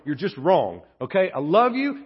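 ordinary delivery

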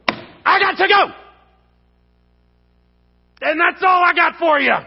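loud, high, fed-up shout; explosive yell of giving up; exasperated outburst. Acted. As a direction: blow up at someone you love. Acts out exasperation with backslider.

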